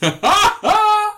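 high, loud devilish cackle. Retold or imitated. Mimicking Sophia mocking two old men, devil cackle. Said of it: "laughing like the fucking devil".

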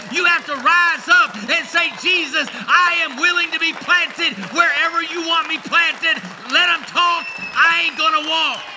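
high and loud, rallying the church; full-force preaching. Acted. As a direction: preach it to the rafters.

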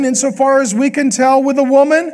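ordinary talk

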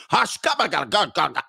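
harsh high-starting guttural fake Swedish; mock Swedish speech. Imitating Swedish women starting to talk, mock guttural Swedish. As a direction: burst into throaty Swedish-sounding gibberish. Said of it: "They start talking to you".

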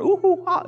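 high-pitched imitation monkey calls; monkey hoots. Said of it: "look at the monkeys. You're going".

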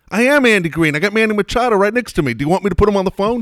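loud, high-pitched retold protest; frustrated insistence. Team manager to a disbelieving coach, loudly frustrated. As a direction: imitate an exasperated caller insisting on being believed. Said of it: "And he's getting frustrated".

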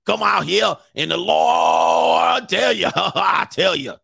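mocking the televangelist's sermon voice; mock preacher. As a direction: imitate a preacher shouting from the pulpit. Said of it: "He was talking like this".

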